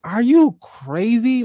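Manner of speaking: high, slow disbelieving question; incredulous. Retold or imitated. Retelling a confrontation with his best friend, high and slow in disbelief. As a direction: stretch it out in total disbelief. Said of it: "and I'm literally like".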